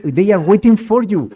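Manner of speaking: loud insistence. Retold or imitated. Retelling how the ashram insisted, loud insistence. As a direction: push it out, they really are expecting you.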